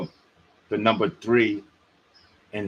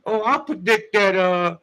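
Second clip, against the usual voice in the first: mocking smug predictor; 8 semitones higher than usual. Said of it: "Talk about".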